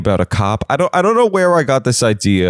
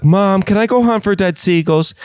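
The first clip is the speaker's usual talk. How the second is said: put-on higher whiny kid asking mom; a lightly voiced eager child begging; 9 semitones higher than usual. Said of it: "The kids are like".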